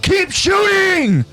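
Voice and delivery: high, strained captain yelling orders; commanding shout. Acted. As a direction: bark orders over the cannon fire. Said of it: "Then Captain Karsten responds".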